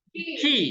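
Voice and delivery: ki with a hard k sound, high-pitched and pointed; said ki, not ci. Shown as a demonstration. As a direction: snap up on ki, hard k and ee. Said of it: "bacanya bukan ci".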